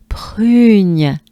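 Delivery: "prugne" with a throaty R and nasal gn; French prugne. Shown as a demonstration. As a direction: press the lips for "prugne". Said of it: "That's the hard part".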